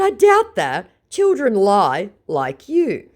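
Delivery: very high then slow, loud put-down; mocking high jab; scornful taunt. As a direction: talk down to him like a child. Taunting scammer in chat.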